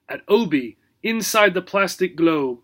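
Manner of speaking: ordinary delivery, nothing marked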